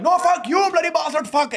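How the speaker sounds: put-on very high, loud comic Indian accent; mock Indian accent. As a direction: yell the insult in a fake Indian accent. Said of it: "I was like".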